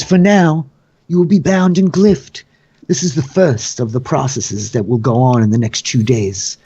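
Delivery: high, ceremonious master of the games; Vergen. Acted. Host announcing the proceedings to bound captives, theatrical and formal.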